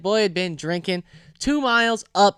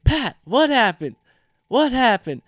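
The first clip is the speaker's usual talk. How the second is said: higher, insistent demand; urgent questioning; 5 semitones higher, 4 dB louder than usual. Retold or imitated. Friend walking in to question Pat, urgent. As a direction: retell yourself pressing a friend for answers.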